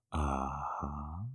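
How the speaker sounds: normal talking voice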